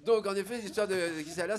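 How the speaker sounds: ordinary talk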